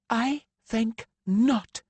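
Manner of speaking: high, slow, crisp refusal. A narrator's reading. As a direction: cut them off crisply. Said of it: "she said crisply".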